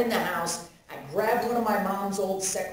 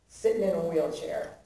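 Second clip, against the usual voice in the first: ordinary talk, nothing marked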